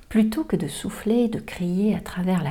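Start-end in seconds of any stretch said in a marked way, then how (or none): none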